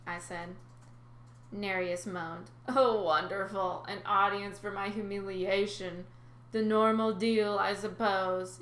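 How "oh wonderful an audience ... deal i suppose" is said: slow, dripping sarcasm; sarcastic resigned sea god; sour sarcasm. Acted. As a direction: grumble it like a defeated old man. Nereus sulks, defeated.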